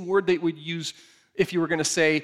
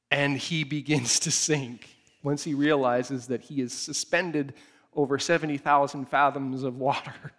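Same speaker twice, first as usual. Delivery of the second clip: ordinary delivery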